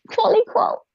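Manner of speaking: cooing. Real, not acted. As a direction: gush at the little creature in a squeaky high voice. Cooing over a cute animal.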